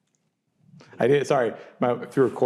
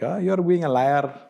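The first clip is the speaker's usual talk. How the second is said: put-on higher-pitched Indian-accented mom; 8 semitones higher, 4 dB louder than usual. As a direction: exclaim it as a dismayed mother with an Indian accent. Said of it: "my mom with her accent".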